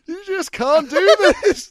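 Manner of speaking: high, loud, drawn-out laughing protest; incredulous and cracking up; laughing disbelief. Retold or imitated. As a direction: wail it slowly through laughter. Retelling shocked reaction, laughing.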